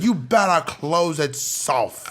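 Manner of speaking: slow, deliberate parental threat; mock-stern, measured command; warning mom. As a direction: imitate a stern mother stretching out the warning. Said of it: "his mom goes, like".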